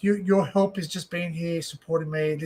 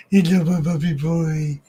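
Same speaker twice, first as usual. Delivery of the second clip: slow cooing pet voice, a touch louder; pet talk; 5 dB louder, slower than usual. Real, not acted. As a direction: coo slowly at the cat. Cooing at the cat.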